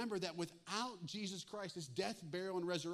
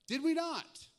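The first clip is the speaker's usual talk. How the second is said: high loud Did we not with we hit hard; loud indignant question; 11 semitones higher, 9 dB louder, slower than usual. Shown as a demonstration. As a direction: throw it out as a loud protest. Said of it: "underline this".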